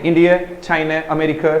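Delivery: इंडिया, चाइना, अमेरिका ending in a short soft e; final syllables barely stressed; light final vowel. Shown as a demonstration. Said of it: "जोर लगा लगा के बोलते हैं".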